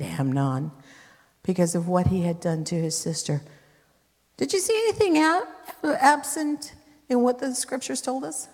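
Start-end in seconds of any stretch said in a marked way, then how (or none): 4.4-8.5 excited high question. Real, not acted.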